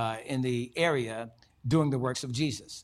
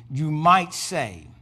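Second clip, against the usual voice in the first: loud, slow emphasis; 3 semitones higher, 9 dB louder, much slower than usual. Acted. Preacher building the quote.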